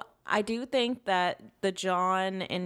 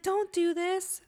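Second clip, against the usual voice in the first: pleading Chucky; 12 semitones higher, slower than usual. Retold or imitated. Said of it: "He's like".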